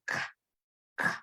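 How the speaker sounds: bare k sound, no vowel; teacher demonstrating the k sound; hard C sound. Shown as a demonstration. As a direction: say "k" as an isolated consonant, pausing between. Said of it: "both have that same sound".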